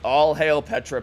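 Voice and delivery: lively cheer. Real, not acted. Streamer echoing a fan of Petra, lively cheer. Reading a chat cheer aloud.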